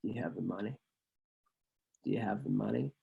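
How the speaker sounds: low, soft, cold mob-style question; menacing tough guy. Retold or imitated. Staten Island dude in the Camaro demanding the money, low and cold. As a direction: lean in quiet and cold, no kindness. Without the hint of kindness.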